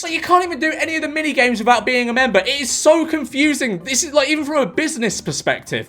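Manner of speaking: high-pitched, exasperated rant; exasperated complaint. Real, not acted. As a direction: complain in baffled frustration, pitch up. Heated complaint about membership limits.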